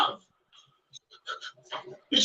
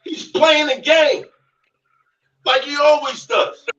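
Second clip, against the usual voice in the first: higher, louder and slow; slow rising shout; 8 semitones higher, 3 dB louder than usual. Real, not acted. Calling out his rival, slow rising shout. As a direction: drag it out and yell it.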